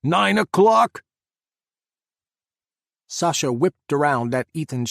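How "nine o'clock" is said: loud, higher, slow drawn-out shout; hollered. A narrator's reading. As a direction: stretch out the warning shout. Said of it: "he hollered".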